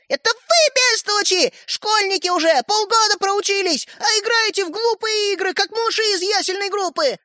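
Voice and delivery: very high indignant tirade; furious girl. A narrator's reading. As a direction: scold them in a high voice. Sister insults brothers in a quarrel. (сердито, высоким голосом)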